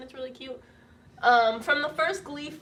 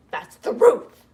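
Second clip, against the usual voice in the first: higher, loud burst on the phrase; loud exclamation; 5 semitones higher, 10 dB louder, slower than usual. Real, not acted. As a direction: blurt it out over the banging. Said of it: "I'm a loud person".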